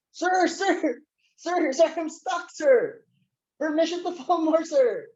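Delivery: high, pleading calls of sir; panicked soldier. Retold or imitated. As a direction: beg the captain for help from midair. Stuck midair calling captain.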